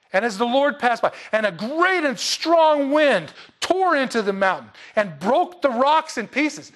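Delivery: loud, high, emphatic Bible reading; loud scripture reading. Acted. Preacher reading Elijah's complaint aloud to the church, emphatic and loud. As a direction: proclaim the verses to the congregation. Reads the wind passage.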